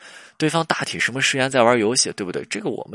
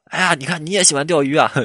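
over-eager small talk; 6 semitones higher, 4 dB louder than usual. Acted. Acted over-eager approach to boss.

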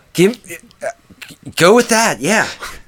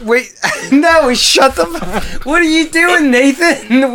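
high, laughing protest; cracking up mid-objection; laughing disbelief; 9 semitones higher than usual. Real, not acted. Player reacts to partner's blunder.

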